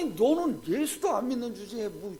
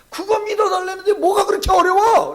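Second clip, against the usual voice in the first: loud, high, exasperated challenge; exasperated shout; 10 semitones higher, 13 dB louder than usual. Retold or imitated. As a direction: throw the question at him. Pastor's climactic retold challenge. (답답해서 높이 외치는 목소리)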